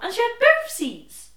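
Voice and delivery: high, loud disbelieving punchline; indignant disbelief. Real, not acted. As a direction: land the outrage of it. Punchline of the seat story.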